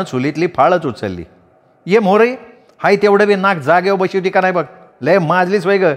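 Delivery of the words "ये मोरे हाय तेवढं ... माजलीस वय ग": higher village woman's scolding voice; Radhi scolding. A narrator's reading. (भांडणारी बाई, ओरडून टोमणा)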